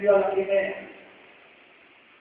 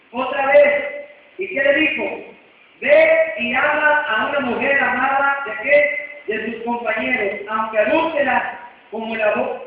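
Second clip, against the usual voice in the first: louder, rising fervent sermon voice; preaching; 4 dB louder than usual. Acted. Pastor urging the congregation, fervent preaching.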